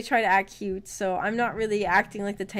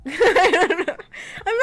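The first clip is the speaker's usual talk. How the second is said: laughing; 9 semitones higher, 8 dB louder than usual. Real, not acted. Laughs at own joke.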